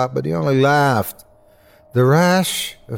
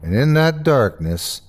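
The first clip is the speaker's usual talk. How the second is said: ordinary delivery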